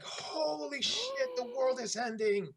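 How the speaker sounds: high, slow, shocked gasp of outrage; mock outrage. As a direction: ham up a scandalised reaction. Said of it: "and it's like".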